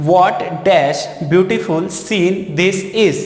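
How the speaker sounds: What dash beautiful scene this is बहुत धीरे, हर शब्द अलग; डिक्टेशन की तरह धीरे बोलो; slow dictation. Shown as a demonstration. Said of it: "इस तरह से". (छात्रों को प्रश्न धीमे पढ़कर सुनाना)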